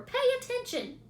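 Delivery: waking the sleeping rat; scream. A narrator's reading. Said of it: "screamed Wilbur".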